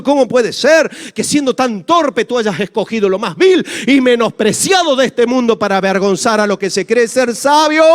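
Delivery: high, loud fervent preaching; full-force preaching. Acted. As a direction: preach it out with rising passion. (predicación ferviente a toda voz)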